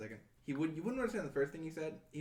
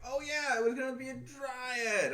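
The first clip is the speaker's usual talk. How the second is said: dopey recollection; 8 semitones higher, 4 dB louder, slower than usual. Retold or imitated. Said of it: "and then he's like".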